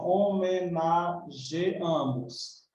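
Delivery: "homenageamos" very slow, syllable by syllable; slow syllable demonstration. Shown as a demonstration. Teacher testing the form for students, slow syllabled "homenageamos". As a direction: spell out "homenageamos" by sound, ge-A with no i glide. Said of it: "se eu falar assim".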